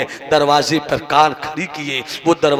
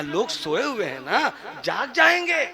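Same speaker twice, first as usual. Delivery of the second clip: much softer, half-laughing hush; hushed warning; 6 semitones higher, 7 dB quieter than usual. Acted. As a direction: hush her, people are sleeping. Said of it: "कहा". (धीमी, हँसी भरी फुसफुसाहट जैसी चेतावनी)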